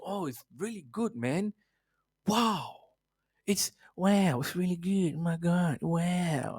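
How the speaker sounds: a light, dreamy surfer-dude impression; Owen Wilson impression. Mocking pundits who praise the transfer, as a soft laid-back impression. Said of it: "I'm trying to do my Owen Wilson".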